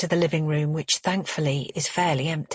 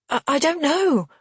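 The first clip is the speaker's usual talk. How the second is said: flustered; 10 semitones higher, 4 dB louder than usual. Acted. Narrator flustered.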